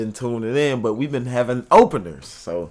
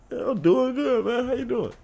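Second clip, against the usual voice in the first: squeaky high greeting; high goofy voice; 12 semitones higher, 3 dB quieter than usual. Pretend. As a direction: pitch it way up, playful.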